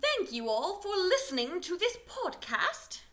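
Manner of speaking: high, slow put-on British accent; mock-British thank-you, with long halting pauses; Young Hermione. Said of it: "your British accent is based on Young Hermione".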